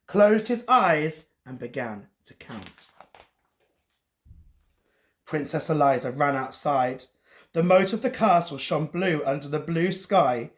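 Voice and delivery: ordinary delivery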